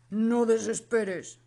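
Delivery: calm royal reassurance; king voice. Acted. King reassures his son.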